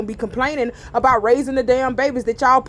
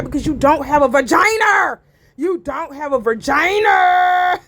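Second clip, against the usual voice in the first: shouting; 2 semitones higher than usual. Real, not acted. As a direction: yell it right at them. Shouting at men.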